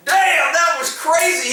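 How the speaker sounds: high, drawn-out awed reaction; impressed amazement. Retold or imitated. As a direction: imitate a boss who is secretly thrilled. Sergeant's unexpectedly impressed reaction.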